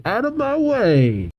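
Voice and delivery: high, stretched-out mock holler; calling out. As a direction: clear the path with a long, high call. Gold barging through the crowd.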